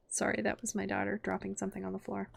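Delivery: ordinary delivery, nothing marked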